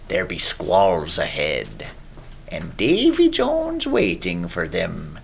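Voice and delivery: put-on gruff old pirate voice, slow and growled; salty old pirate. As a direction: growl it like an old sea dog. Sudden pirate voice, festival aside.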